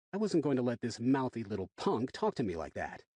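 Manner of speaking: ordinary delivery, nothing marked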